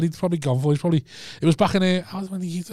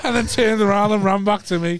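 ordinary talk

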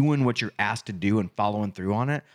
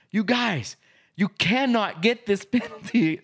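higher-pitched mock scolding, slowing down; retold yell; 9 semitones higher, slower than usual. Coach telling his players off over a penalty, mock yelling. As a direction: imitate a coach's exasperated yell, only lightly raised. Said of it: "And I yell at him".

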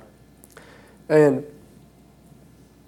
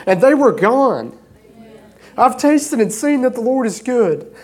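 higher, louder preaching that builds with each testimony; preaching at full force; 7 semitones higher, 3 dB louder than usual. Acted. Pastor testifying to his congregation about healings, preaching at full force. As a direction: lift the room with every answered prayer.

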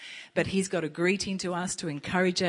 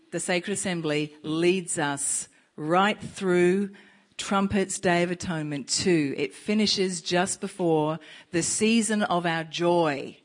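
preaching; 5 dB louder than usual. Acted.

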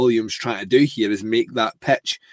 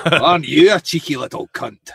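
a hammed-up Scottish echo, a bit louder; mock the Scotsman back; Scottish accent; 3 semitones higher, 4 dB louder than usual. Host parrots the Scottish line in a Scottish accent. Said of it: "your best Scottish impression ever".

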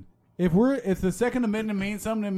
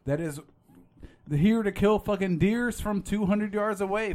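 ordinary talk, nothing marked

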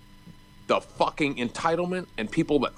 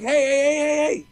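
retold shout; 4 dB louder than usual. Retelling near-miss with car.

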